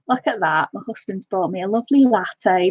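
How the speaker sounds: ordinary talk, nothing marked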